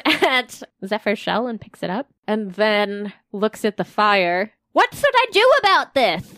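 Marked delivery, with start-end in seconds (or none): 4.7-6.3 character voice. Acted. [name] voiced in fire scene.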